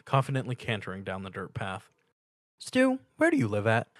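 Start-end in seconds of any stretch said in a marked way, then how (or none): 2.6-3.9 lightly voiced Pinkie, oddly calm; a high pony voice held oddly calm. A narrator's reading. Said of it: "Pinky asked in an oddly calm tone".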